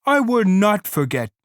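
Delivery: high, loud protest; Lenny shouting back at the rabbit. A narrator's reading. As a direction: insist loudly and high. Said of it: "Lenny said loudly".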